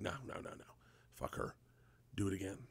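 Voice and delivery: soft, offhand dismissal; hushed reassurance. Retold or imitated. Crew reassures the actor aside, quietly dismissive. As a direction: imitate crew quietly waving off a complaint.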